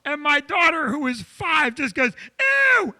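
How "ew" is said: put-on high-pitched, grossed-out kid squeal; disgusted little girl. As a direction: imitate a five-year-old's revulsion. Five-year-old daughter walks in, disgusted.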